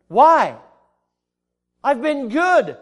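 loud, high objection; acted protest. Sinner refused heaven by God, acted protest. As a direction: argue back as if wronged.

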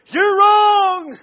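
high, loud shout with a falling end; accusing shout. Acted. Accuser pointing a finger in his face, accusing shout.

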